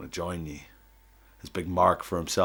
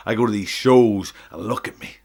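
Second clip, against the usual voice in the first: loud promo; 4 semitones higher, 12 dB louder than usual. Acted. Wrestling promo speech.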